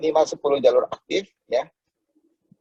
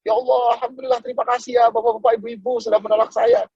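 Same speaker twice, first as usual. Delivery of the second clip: higher and brighter, overjoyed thanks; mock jubilant shout; 7 semitones higher than usual. Salesman thanking villagers who rejected him, jubilant. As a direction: cry out thanks as if you'd won. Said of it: "dia teriak, loncat, kegirangan".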